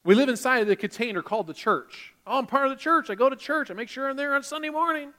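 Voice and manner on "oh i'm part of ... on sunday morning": higher, lightly voiced smug churchgoer; a preacher mimicking a self-satisfied member; mock churchgoer. Preacher to congregation, mimicking a complacent churchgoer, pitched higher.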